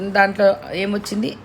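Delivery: ordinary talk, nothing marked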